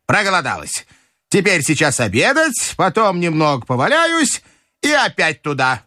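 high-pitched grandmother giving orders; бабушка распоряжается. Acted. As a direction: announce plans in a high old-lady voice. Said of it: "крикнула бабушка". (высокий деловитый голос бабушки)

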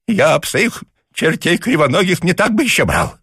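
put-on outraged old man. A narrator's reading. Papasha outraged.

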